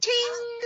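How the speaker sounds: ting sound effect. Pretend.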